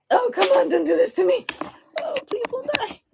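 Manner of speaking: high pleading whine at the phone; desperate pleading. Real, not acted. Streamer begging her dying phone, high desperate plea. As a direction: beg the phone not to die.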